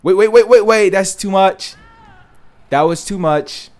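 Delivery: loud, high startled shouting; sudden alarmed outburst; panicked yell. Real, not acted. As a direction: yell in panic as you nearly fall. Character nearly falls off ledge.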